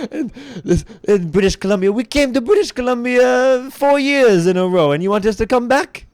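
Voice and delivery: put-on high, loud Quebecois French accent. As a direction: push a thick Quebecois accent. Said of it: "Quebecois impersonation".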